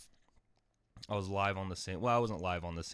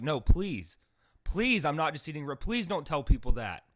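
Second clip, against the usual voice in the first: loud desperate begging, pitched up; pleading; 6 semitones higher, 7 dB louder than usual. Retold or imitated. Begging the store clerk not to tell everyone, loud pleading. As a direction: beg her loudly not to tell.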